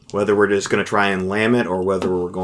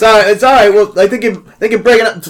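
flustered excuse; 12 semitones higher, 8 dB louder than usual. Acted. Player voices his character brushing off caller.

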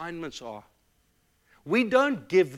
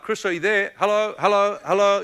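higher, louder calls into a silent phone; calling down the line; 7 semitones higher, 9 dB louder than usual. Retold or imitated. Friend on the phone to weeping pastor, calling out. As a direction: imitate a caller checking someone's still there.

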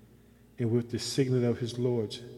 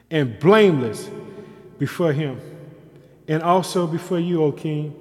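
loud, raised declamation; preached scripture reading; 6 semitones higher, 10 dB louder than usual. Real, not acted. Daniel answering the king, proclaimed in full preaching voice.

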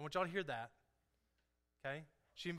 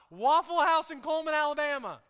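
high, much louder, sustained proclamation; emphatic preacher shout; 8 semitones higher, 14 dB louder than usual. Real, not acted. Preacher stressing to church it happened locally, emphatic shout. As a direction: belt out the place name to the room.

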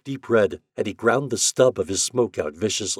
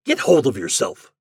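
snapping; 4 semitones higher, 7 dB louder than usual. Acted. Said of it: "he snapped".

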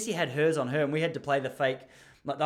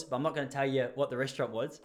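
usual speaking voice

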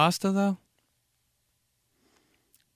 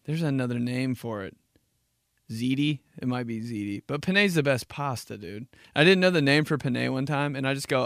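normal talking voice

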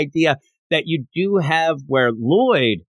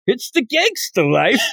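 mock gangsta; 8 semitones higher than usual.

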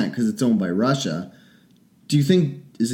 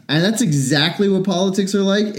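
normal talking voice

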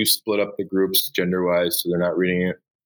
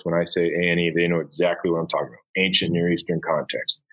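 ordinary delivery

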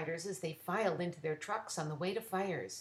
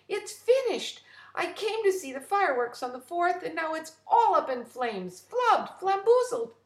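high, loud and frantic; panicked; 10 semitones higher, 9 dB louder than usual. Acted. A visitor pours out the bad news, high and frantic. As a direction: she blurts out the disaster in a rush. Frida panicking about the fireworks.

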